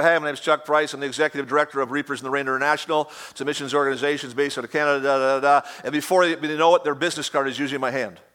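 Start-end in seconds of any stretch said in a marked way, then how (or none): 0.0-5.7 rapid pitch. Retold or imitated. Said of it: "I sit down and go".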